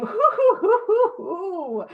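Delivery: high hooting laugh, fading; laughing hoots. Retold or imitated. Reading the grim verdict, laughing high hoots. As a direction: hoot it out laughing. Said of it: "I'm like".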